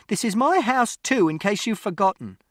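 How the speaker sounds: high, loud furious mother; mother shouting at father in a row, high and loud; shouting back. Acted. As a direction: yell it back at him, standing your ground. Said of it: "And Mother shouted".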